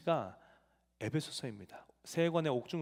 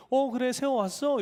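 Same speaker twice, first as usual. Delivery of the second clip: teacher's glad greeting, lifted higher; warm welcome; 8 semitones higher, 3 dB louder than usual. Retold or imitated. Father imitating the teacher's warm welcome, higher and glad. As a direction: welcome the boy warmly, like a fond teacher. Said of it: "되게 반갑게 따뜻하게 맞춰주시더라고".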